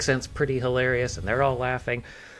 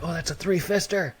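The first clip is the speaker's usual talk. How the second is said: high, mock Scottish exclamation; bad Scottish accent; 9 semitones higher than usual. Imitating Scots spotting a mullet, bad Scottish accent. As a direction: call it out in a badly faked Scottish accent. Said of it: "this is a terrible accent".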